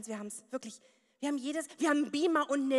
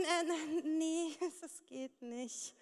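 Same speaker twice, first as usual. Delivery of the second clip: soft, slow, embarrassed giggle; mock sheepish refusal; sheepish reply; 6 dB quieter, much slower than usual. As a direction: shrink away from the question. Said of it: "ich würd dann so schreiben".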